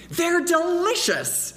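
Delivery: put-on high, slow little-boy voice; child's voice. Boy tempting his friend, high slow kid voice. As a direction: sound like a sly young boy.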